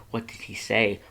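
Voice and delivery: ordinary delivery, nothing marked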